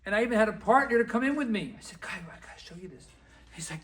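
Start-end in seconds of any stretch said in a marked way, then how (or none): 2.0-3.1 hushed, confiding whisper; excited whisper. Acted. Letting his partner in on a gold mine, confiding whisper. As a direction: whisper it like a secret find.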